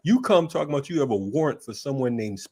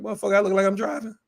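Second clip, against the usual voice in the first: exasperated retort; 7 semitones higher, faster than usual. Retold or imitated.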